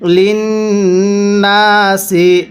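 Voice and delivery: slow, drawn-out tajweed chanting; slow Quran recitation. Shown as a demonstration. Teacher demonstrating recitation to students, slow chant. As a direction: recite each syllable long and measured. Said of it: "তাহলে".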